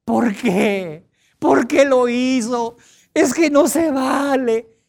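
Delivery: high, loud, slow anguished pleading; jilted lover wailing why; melodramatic lament. Pretend. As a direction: plead the betrayal like a telenovela. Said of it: "empiezas a hacer un alucine".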